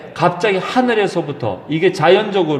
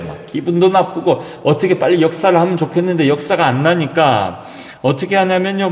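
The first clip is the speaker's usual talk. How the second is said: ordinary delivery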